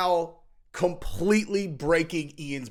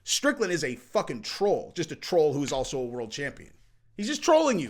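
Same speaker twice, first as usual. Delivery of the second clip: ordinary talk, nothing marked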